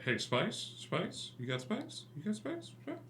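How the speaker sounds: a lightly mocking street-corner hustler; mock street dealer. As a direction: play a shifty dealer hawking spice under his breath. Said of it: "it's more like".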